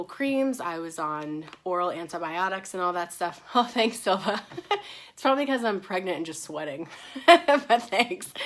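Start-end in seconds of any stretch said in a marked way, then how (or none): 3.5-8.3 laughing. Real, not acted. Laughing at viewer comment.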